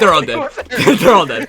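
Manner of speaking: high, loud shout through laughter; laughing exultation. Real, not acted. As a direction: whoop the victory while laughing. Celebrates wiping out enemies, laughing.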